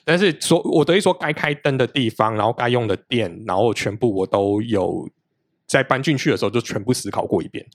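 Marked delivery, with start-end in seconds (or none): none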